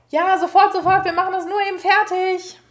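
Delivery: high, loud reply from the kitchen; shouting back. A narrator's reading. Children answering the parent from the kitchen, loud high shout. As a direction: holler back to the adults.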